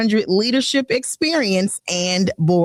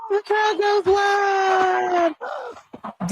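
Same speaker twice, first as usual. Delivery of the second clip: hype announcer; 11 semitones higher than usual. Acted. Radio host hypes applause.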